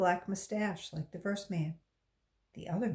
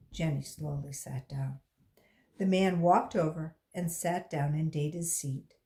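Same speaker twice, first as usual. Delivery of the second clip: usual speaking voice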